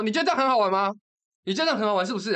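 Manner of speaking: furious reenacted rebuke; retold scolding. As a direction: scold her all over again. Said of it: "我就大骂".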